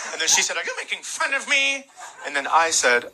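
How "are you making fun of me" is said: high, soft offended customer; much higher, quieter mock-offended voice; cleft palate speech. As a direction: imitate a hurt woman with a speech impediment. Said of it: "also had a cleft palate".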